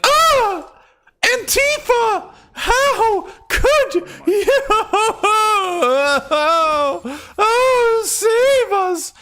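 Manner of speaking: high, sobbing fake wail with laughs; hammed-up crocodile tears, shrieking high; mock crying wail. Streamer mocking conservatives' crocodile tears with a shrieking fake wail. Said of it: "crocodile tears".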